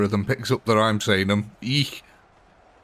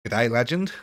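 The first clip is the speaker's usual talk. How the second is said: mock Australian accent. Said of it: "not a good Australian accent".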